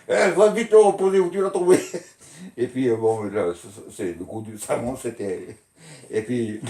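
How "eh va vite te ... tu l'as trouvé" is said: loud, high telling-off; mother scolding. Retold or imitated. Mother ordering her son to return the soap, loud scolding. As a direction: snap at the kid to put it back. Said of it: "Ma mère dit".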